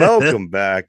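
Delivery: loud. Real, not acted. Host's renewed greeting.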